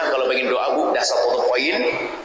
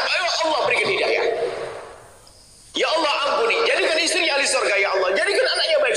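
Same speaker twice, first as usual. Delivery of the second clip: voiced kind prayer; 12 semitones higher than usual.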